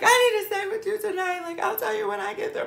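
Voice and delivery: very high, fast, wailing through tears; bawling plea. Retold or imitated. Pleading with Tank for a place to stay, wailing high. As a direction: plead in a squeaky crying wail. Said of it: "Literally bawling".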